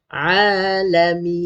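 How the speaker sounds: "Alamin" drawn out, ending on n; demonstrated recitation. Shown as a demonstration. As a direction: recite "Alamin" slowly, long vowels. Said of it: "Ah, sebutan tu". (baca "Alamin" panjang, mati dengan nun)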